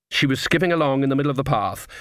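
plain reading voice

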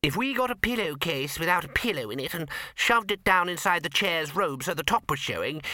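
high-pitched, excited wizard with an idea; eager wizard. A narrator's reading. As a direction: pitch a silly disguise in a high voice. A wizard proposes a disguise.

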